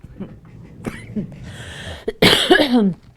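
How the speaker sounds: loud laugh. Real, not acted. Owner laughing out loud at her pets. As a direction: burst out in a big, loud laugh.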